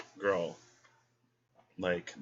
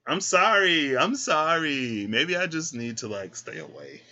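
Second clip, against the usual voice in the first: mock apology; 6 semitones higher, 6 dB louder, slower than usual. Real, not acted. Laughing mock apology after joke.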